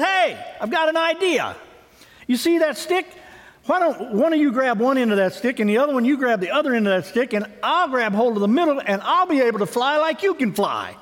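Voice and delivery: high, eager little frog pitching his plan; a frog bursting with a bright idea; excited frog voice. Acted. Frog excitedly pitching his plan to the geese.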